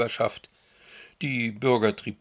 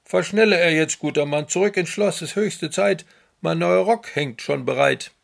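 higher, louder commanding emperor; barking orders; 7 semitones higher, 9 dB louder than usual. Acted. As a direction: snap orders at the coachman. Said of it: "herrscht den Kutscher der bald an".

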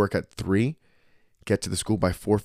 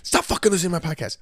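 fast, high-pitched mock outburst; playfully fed up, pitched high; mock exasperation; 9 semitones higher, faster than usual. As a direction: retell a flustered, rapid-fire protest. Retold exasperated reply to partner.

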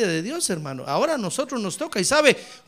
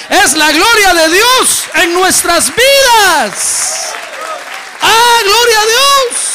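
high, loud, slow drawn-out cry; climactic praise shout; 12 semitones higher, 13 dB louder, much slower than usual. Acted. Pastor to congregation, slow ecstatic shout. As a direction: let the glory burst out.

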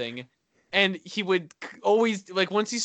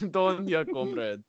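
put-on Russian accent; 4 dB quieter than usual. Teasing friend's warm-up phrase, mock Russian accent. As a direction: imitate a Russian catchphrase. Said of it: "for the Russian one".

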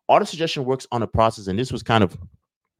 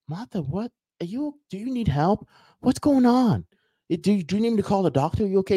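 alarmed coworker; 11 semitones higher than usual. Retold or imitated. Worried coworker, rising alarm.

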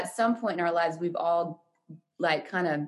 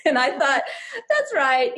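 laughing; 9 semitones higher, 4 dB louder than usual. Real, not acted. Laughing while recalling friend's remark.

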